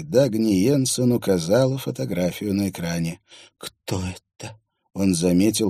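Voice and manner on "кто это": quiet, slow, holding back a tremble; shaky voice. Acted. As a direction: keep the tremor out of your voice. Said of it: "старается контролировать голос, унимая дрожь".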